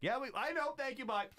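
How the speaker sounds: curt brush-off. Retold or imitated. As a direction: get HR off the phone fast. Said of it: "And be like".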